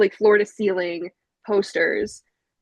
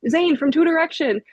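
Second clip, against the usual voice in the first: high, insistent retold explanation; mock exasperation; 7 semitones higher, 3 dB louder than usual. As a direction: spell it out for a clueless dad. Exasperated explanation to teasing dad.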